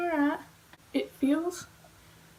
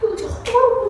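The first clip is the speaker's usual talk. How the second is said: high, loud cry of dismay; horrified wail; 11 semitones higher, 11 dB louder than usual. Real, not acted. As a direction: wail it in real horror. Horror at the botched cut.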